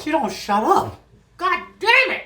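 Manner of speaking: high, loud exasperation; exasperated. Real, not acted. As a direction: throw up your hands. Mock exasperation at co-host.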